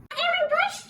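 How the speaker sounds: patient calling out from the room; high patient voice. Retold or imitated. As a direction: call the name high and small. Patient calls name in story.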